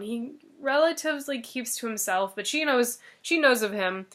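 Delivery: usual speaking voice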